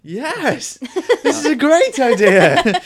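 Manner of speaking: loud, high, slow cheer; excited shout. Acted. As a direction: cheer it like a game show. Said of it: "that sound effect again".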